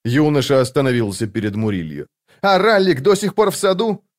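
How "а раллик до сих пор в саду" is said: put-on high-pitched, anxious young man's question; urgent youth. A narrator's reading. Youth asks friends after Rallick, urgent.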